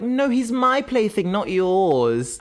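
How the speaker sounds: high, drawn-out mock whine of protest; Rebecca, possessive protest. Acted possessive outburst in a retold scene.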